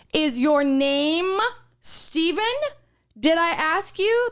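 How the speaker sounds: slow, sneering and rising; mock the snippy coworker dragging it out; snotty retort. Coworker snapping back at her.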